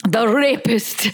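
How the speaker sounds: a light mock Scottish drawl; Sean Connery. Finding a way into the accent, slow Scottish. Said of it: "what does Sean Connery say".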